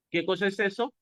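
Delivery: ordinary talk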